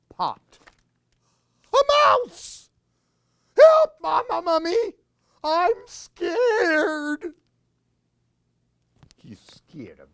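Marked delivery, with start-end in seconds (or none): 1.7-7.3 very high, loud squeak; panicked squeal for help; squeaky mouse panic. Acted. Tiny mouse scares mummy.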